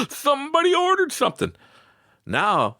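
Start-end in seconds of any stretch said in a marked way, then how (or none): 0.1-1.5 much higher, thrilled squeal of joy; hammed-up delight at a first sale; giddy excitement. Retelling his joy at one order.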